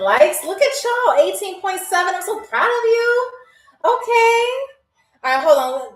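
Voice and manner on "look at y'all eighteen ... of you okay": high, bright cheering at the viewers; excited and proud, pitch way up; excited praise. Real, not acted. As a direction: gush with pride over the like count. Delighted at twenty thousand likes.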